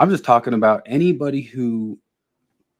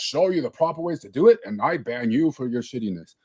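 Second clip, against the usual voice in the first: lightly mocking, holier-than-thou; mocking sanctimonious voice; 2 semitones higher than usual. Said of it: "he's like".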